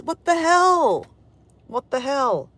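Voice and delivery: real incredulous cry; incredulous outburst. Real, not acted. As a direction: stretch it out in disbelief. Amazed at overcast warm day.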